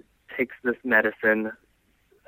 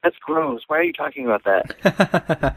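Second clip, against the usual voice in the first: high, squeamish mock disgust; high-pitched "ew, gross" voice; disgusted mimicry; 14 semitones higher, slower than usual. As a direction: imitate a grossed-out friend recoiling. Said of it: "gives them this really disgusted look".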